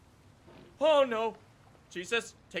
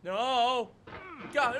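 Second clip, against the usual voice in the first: ordinary delivery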